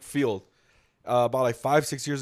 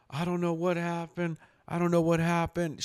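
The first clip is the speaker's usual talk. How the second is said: imitated shaken girl; 4 semitones higher than usual. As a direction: mimic someone dazed after a crash. Shaken girl's words after crash, retold.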